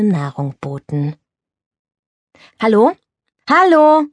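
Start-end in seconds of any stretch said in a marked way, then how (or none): 2.6-2.9 "Hallo" called out loud with a rising question; a first call down the phone line; calling hello. Acted. Calling into the telephone. 3.5-4.1 "Hallo" called much higher and louder; calling again louder. Acted.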